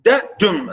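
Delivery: dadum split into short da, falling DUM; low-up iambic beat. Shown as a demonstration. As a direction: step from da up onto DUM in dadum. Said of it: "start low and go".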